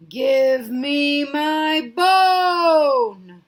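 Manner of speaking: very slow, loud haunting moan.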